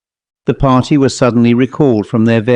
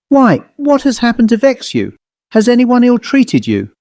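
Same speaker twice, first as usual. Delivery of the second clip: much higher, anxious mistress; concerned lady; 12 semitones higher than usual. A narrator's reading. Lady asking her tearful maid, concerned and high. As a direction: ask with kindly worry.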